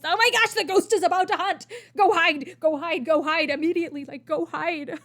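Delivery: urgent, hammed-up alarm; mock panic. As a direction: play up a panicked scramble to hide. Acting out her gaming panic reaction.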